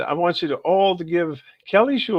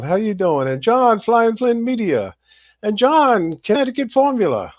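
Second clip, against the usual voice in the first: upbeat, higher host voice calling out names; energetic live-show host welcome; livestream shout-outs; 9 semitones higher, 4 dB louder than usual. Acted. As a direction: hype up each viewer as you greet them. Host welcoming chat viewers by name.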